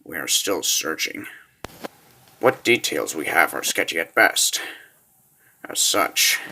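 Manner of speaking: low, subdued military report; grave briefing. Acted. Officer briefing his lord on the kidnapping, grave and low. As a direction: report it softly and low, measured.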